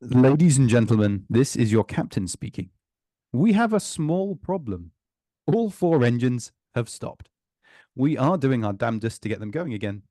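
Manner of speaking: loud, calm British captain announcement. Retold or imitated. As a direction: cut glass English airline captain. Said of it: "in a captain voice".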